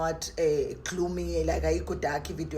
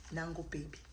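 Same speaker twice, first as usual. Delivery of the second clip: cooing; 15 dB quieter than usual. Real, not acted. Cooing to the camera.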